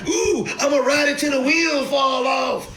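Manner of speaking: mock a greedy holder riding gains; greedy hype shout. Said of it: "and you like".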